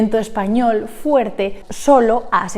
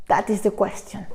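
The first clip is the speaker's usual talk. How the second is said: Hamlet quote; 6 dB quieter than usual. Acted. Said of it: "¿Acento o no acento?".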